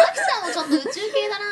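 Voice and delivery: laughing. Real, not acted. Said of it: "うーん、まあまあ".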